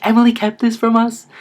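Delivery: high, loud, scandalized gasp of a question; shocked. Retold or imitated. Relatives learn his sister kept his secret, high, loud and shocked. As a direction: act out the aunts' disbelief.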